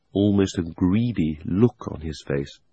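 plain reading voice

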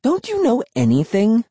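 high-pitched scornful question; exasperated. A narrator's reading. Emily insulting the narrator's ignorance, exasperated. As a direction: ask it incredulously, pitch way up.

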